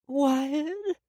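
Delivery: high, aching call of his name; pained call. A narrator's reading. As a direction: call out to him in pain, rising. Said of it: "I heard her painful voice call out".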